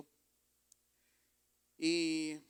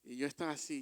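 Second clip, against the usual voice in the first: ordinary talk, nothing marked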